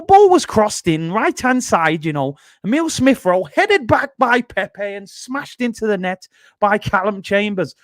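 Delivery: high, loud Scouse impression; Scouse accent. Shown as a demonstration. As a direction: have a go at a Scouse commentator. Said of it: "My Scouse accent ain't that good".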